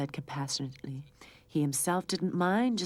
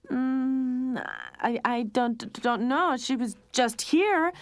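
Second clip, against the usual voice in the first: high, loud nervous stammer; stuttering; 8 semitones higher, 5 dB louder, slower than usual. A narrator's reading. Lionel lying to his mother, nervous stammer. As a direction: stumble over the lie. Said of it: "Lionel was stuttering".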